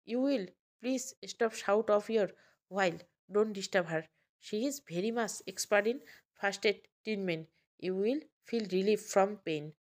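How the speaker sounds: very slow, word by word broken English; painfully slow careful English; halting child English. Acted. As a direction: spell out each English word slowly like a small child.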